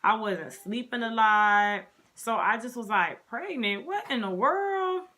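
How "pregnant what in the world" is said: higher-pitched retold astonishment; incredulous mock shock, climbing high; shocked disbelief. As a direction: act out baffled surprise. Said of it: "I was so shocked".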